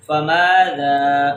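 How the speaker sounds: فماذا slow and low, both aa held; short fa, then stretch the rest of فماذا; long vowels. Shown as a demonstration. Teacher models alif madda, فماذا drawn out. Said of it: "اپنی آواز کو لمبا کر رہے ہیں".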